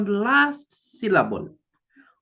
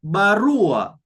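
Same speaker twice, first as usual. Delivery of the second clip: Barua with RU punched louder; Barua, strong RU falling away on A; stress on second-last syllable; 5 dB louder than usual. Shown as a demonstration. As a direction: push hard on the RU of Barua. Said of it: "the second last syllable".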